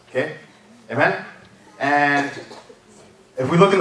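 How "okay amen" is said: usual speaking voice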